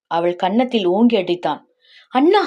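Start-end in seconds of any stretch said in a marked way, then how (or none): 2.1-2.4 high-pitched, rising yelp; shocked cry. A narrator's reading. Sister cries out after being slapped. (அதிர்ச்சியில் கூவும் குரலில்)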